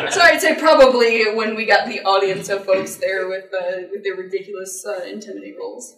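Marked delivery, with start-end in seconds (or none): none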